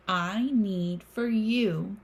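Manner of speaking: plain reading voice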